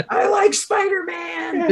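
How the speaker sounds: slow, high-pitched mock whine; hammed-up child's lament through laughter; devastated little kid. Little kid upset at the movie, slow mock wail. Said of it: "Some little kid falls to his knees".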